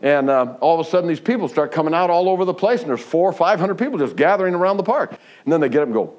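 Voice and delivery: ordinary talk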